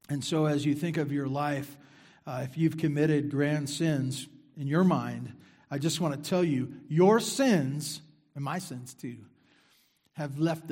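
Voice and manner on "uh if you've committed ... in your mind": normal talking voice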